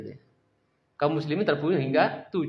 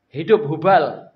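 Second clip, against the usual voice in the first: victory cry; 10 dB louder, much slower than usual. Acted. Abu Sufyan cheers his idol.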